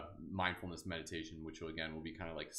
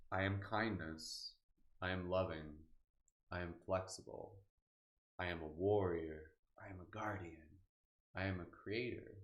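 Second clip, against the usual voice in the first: affirmation meditation. Acted. Teacher leading a live group through affirmations, calm and unhurried.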